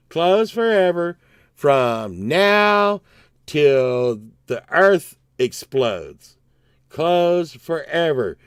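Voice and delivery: slow, drawn-out emphasis; emphatic disbelief. Real, not acted. Regular mourning his favorite donut shop, emphatic disbelief. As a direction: stretch each word out for finality.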